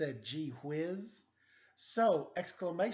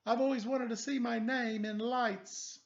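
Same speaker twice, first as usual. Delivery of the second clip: higher, wistful voice; dreamy character voice; 8 semitones higher, 4 dB louder than usual. Acted. Letter B confiding her dream of fame, wistful. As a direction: dream aloud about fame.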